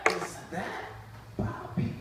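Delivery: hushed, stunned whisper; awed whisper. Retold or imitated. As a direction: whisper it in disbelief. Chef spots Bobby, awed.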